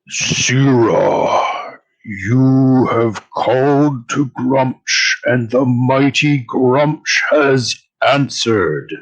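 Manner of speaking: slow, booming divine summons; booming god voice. Acted. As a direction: boom it out slowly like a god. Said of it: "you hear a booming voice".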